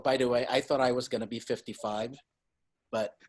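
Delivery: normal talking voice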